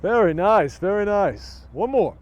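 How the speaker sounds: loud praise. Real, not acted. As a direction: call out your approval over the distance. Calls out to cheer squad after routine.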